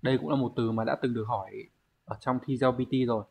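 ordinary talk

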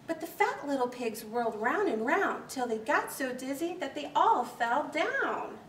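plain reading voice

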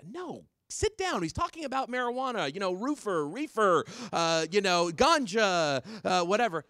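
very high, imitated scolding vice principal; hammed-up, high-pitched adult shutting a kid down; flustered vice principal. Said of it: "And she was like".

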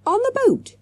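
very high, sudden exclamation; excited high-pitched cry; cried out. A narrator's reading. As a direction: burst out with a realisation. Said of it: "he cried".

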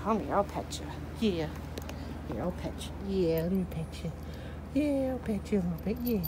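hushed and tender, nearly whispered; soothing pet talk. Real, not acted. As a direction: murmur affectionately while petting. Soothing the dog while petting it.

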